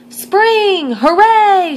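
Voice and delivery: high, loud joyful cheer; cheering shout. Acted. Reporters celebrating the forecast, loud joyful cheer. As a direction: whoop for joy. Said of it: "shouted the reporters".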